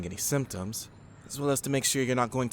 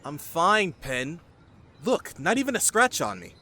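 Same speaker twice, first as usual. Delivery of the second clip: eager reassurance; 6 semitones higher, 5 dB louder than usual. Acted. Reassuring worried Penn.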